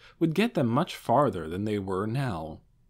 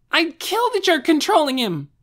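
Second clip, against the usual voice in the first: put-on high, loud, fierce young girl's voice; fierce girl's threat; 9 dB louder than usual. Young Aura vowing to kill Ainz's controller, high and loud. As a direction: snarl it out like a furious little girl.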